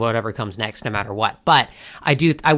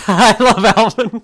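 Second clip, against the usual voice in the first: laughing gush; 9 semitones higher, 9 dB louder than usual. Real, not acted. Laughing fan gushing.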